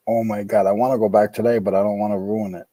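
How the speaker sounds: ordinary talk, nothing marked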